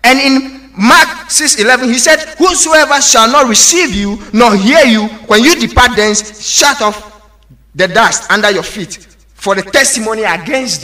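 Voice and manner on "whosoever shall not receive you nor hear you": normal talking voice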